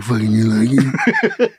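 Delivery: low, slow voice right in her ear; low, drawn-out come-on; dad in bed. Acted. Son overhears dad talking in mom's ear, low and slow. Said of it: "you're right in her ear".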